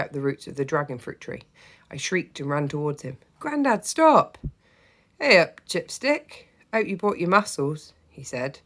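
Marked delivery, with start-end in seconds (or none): 3.4-4.4 put-on high, loud child's shriek; shrieked shout. Child shrieks to stop grandad. 5.2-7.9 put-on slow, jolly old grandad; a hint of a hearty Yorkshire grandad; cheerful grandad voice.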